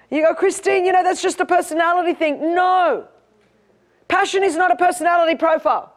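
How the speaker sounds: high-pitched, driving sermon delivery; full-force preaching. Acted.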